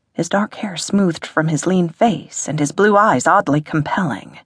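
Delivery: usual speaking voice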